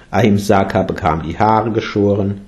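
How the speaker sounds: plain reading voice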